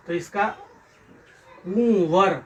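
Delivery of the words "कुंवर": कुंवर drawn out as कुं-वर; careful pronunciation. Shown as a demonstration. Teacher showing pupils how कुंवर is pronounced, drawn out. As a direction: stretch the nasal first syllable of कुंवर. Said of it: "क्या इसका उच्चारण होगा".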